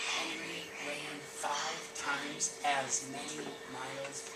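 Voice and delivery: soft, slow whispered reading. Shown as a demonstration. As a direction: whisper it slowly to the class. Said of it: "read it at a whisper the first time".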